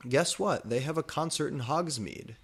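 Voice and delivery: usual speaking voice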